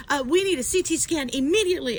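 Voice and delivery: acted-out urgent fussing; doctor in a tizzy. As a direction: imitate a doctor raising a needless alarm. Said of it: "it sent her into an absolute tizzy".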